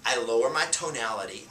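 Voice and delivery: ordinary delivery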